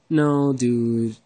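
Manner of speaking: normal talking voice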